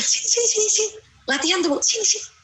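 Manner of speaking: put-on high coaxing call to a small child, sini stretched; a mother beckoning her child, high and coaxing. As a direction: call the little one over, sweetly high. Mother calling child to come.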